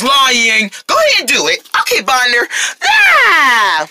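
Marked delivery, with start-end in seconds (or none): 2.8-3.9 high, drawn-out falling scream; flipping scream. Acted. Character launching into a flip for friends, flipping scream. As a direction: let out a long yell that slides down.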